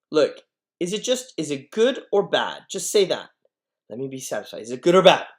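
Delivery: high, loud, urgent demand; impatient teenager. Acted. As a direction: demand an answer now. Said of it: "just real impatience".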